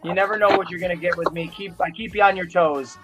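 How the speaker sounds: ordinary talk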